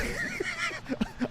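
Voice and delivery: soft, high-pitched giggling; real laugh. Real, not acted. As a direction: giggle helplessly to yourself. Laughs at his own reaction.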